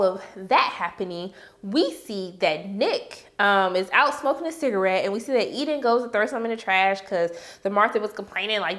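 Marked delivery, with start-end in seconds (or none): none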